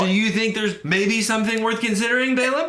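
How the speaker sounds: high, reasonable reproach to her rider; pointed donkey voice. Acted. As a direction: sound like a patient animal making her point. Voicing the donkey answering Balaam.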